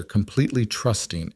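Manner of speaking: ordinary delivery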